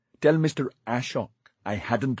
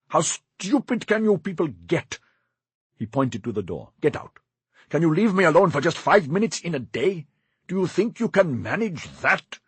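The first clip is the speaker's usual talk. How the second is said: higher, louder contemptuous rage; angry outburst; 6 semitones higher, 4 dB louder than usual. Acted. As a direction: berate him with scornful fury. Master furious at servant.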